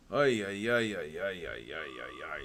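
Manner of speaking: fading, sinking ay ay ay; despairing groan. Real, not acted. Groaning at falling gold.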